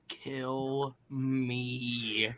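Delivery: soft, low, drawn-out groaning plea; mock-exhausted, quiet misery; weary despair. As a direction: say it as if bored to death. Acts out listener's weary despair.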